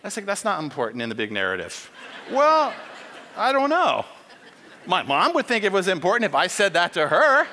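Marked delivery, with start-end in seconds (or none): 0.3-1.9 low, quick brush-off; mumbled dodge. Retold or imitated. Spy dodging the officer's question, low and quick. 2.3-7.5 louder, laughing comeback; indignant retort. Retold or imitated. Officer pushing back at the spy, loud and laughing.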